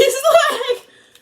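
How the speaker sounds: cracking up at herself; sudden shrieking laugh; loud laugh. Real, not acted. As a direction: burst of high laughter. Laughs at own narcissism.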